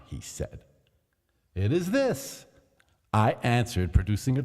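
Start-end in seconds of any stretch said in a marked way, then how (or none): 1.5-2.5 higher, knowing answer; sly reveal. A narrator's reading. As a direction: show the trowel with dark humour.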